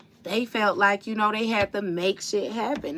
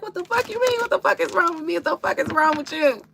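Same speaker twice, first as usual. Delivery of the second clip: much higher, fiery shouted comeback; shrill comeback; 11 semitones higher, 4 dB louder, faster than usual. Retold or imitated. Retelling the movie, she shouts back at him, high.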